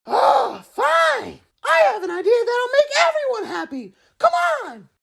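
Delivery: Naruto. Acted. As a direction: yell it out, cocky and impatient. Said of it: "It is Naruto!".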